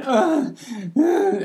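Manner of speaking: high, loud anguished ugh ugh; mock wailing groans. Retelling her breakdown, a mock wailing groan. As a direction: wail like a woman coming apart. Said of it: "just wailing and throwing herself about".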